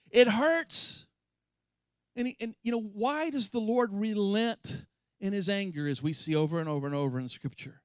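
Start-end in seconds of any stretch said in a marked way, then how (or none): none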